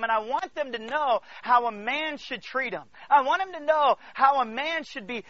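loud, urgent preacher in full flow; impassioned preaching. Real, not acted. Preacher telling church what he wants for his daughters, impassioned. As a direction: drive it home with fatherly conviction.

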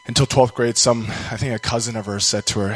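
ordinary talk, nothing marked

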